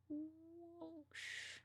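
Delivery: high, drawn-out whoosh. Real, not acted. Tracing the bat's wings while drawing, whoosh. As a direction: draw out a high "whoo" into "sh". Voices the shape of bat wings.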